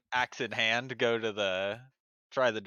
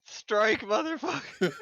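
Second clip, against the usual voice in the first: high, laughing victory call; loud high-pitched celebration breaking into laughter; gleeful cheer; 10 semitones higher, 3 dB louder, much slower than usual. Real, not acted. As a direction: crow it like a bowler after a strike. Celebrates zombies bowled over.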